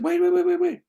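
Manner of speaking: high rapid "wait" repeated; urgent stopping. Retold or imitated. Bill stops the crying wife, high urgent protest. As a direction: cut her off, pitched far up.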